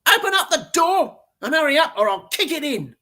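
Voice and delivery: high, loud bratty shout; nasty little girl shouting. Acted. The brat yelling at the door to be let in. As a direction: bark it like a spoiled brat. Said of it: "a nasty little voice shouted".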